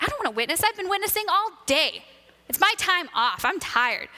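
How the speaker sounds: high-pitched mock protest, dropping at the end; whiny protest. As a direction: complain like an overtired servant. Acted tired protest to God.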